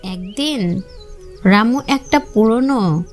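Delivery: ordinary delivery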